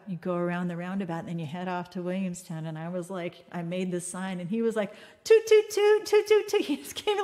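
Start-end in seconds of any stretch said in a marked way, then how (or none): 5.2-6.6 high, loud repeated horn toots; imitated car honks. Storyteller imitating the grateful driver honking goodbye, excited honks. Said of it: "like, a hundred honks".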